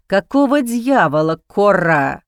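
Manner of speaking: male voice, low and disbelieving; a dean caught off guard; surprised, disbelieving. A narrator's reading. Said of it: "Голосом, полным удивления и даже недоверия". (удивлённо, с недоверием)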